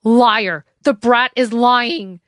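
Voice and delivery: loud angry snap, slow; snapping. A narrator's reading. Harrington denying Sammy's accusation, angry snap. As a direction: spit out the denial angrily. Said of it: "snapped Harrington".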